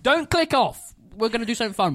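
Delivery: loud, high plea to viewers; calling out. Real, not acted. Podcaster urging viewers to stay for Pokemon cards, calling out. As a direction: shout to keep them watching.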